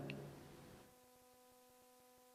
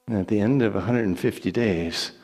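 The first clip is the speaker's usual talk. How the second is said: ordinary delivery, nothing marked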